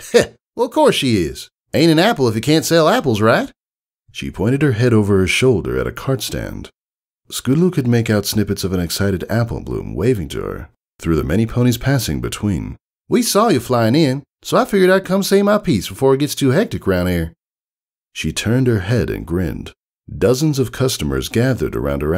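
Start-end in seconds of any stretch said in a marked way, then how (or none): 0.1-3.5 put-on high, louder Southern farm girl; Southern country mare. Applejack voiced in story. 13.1-17.3 put-on high, louder Southern farm girl; Southern country mare. Applejack voiced in story.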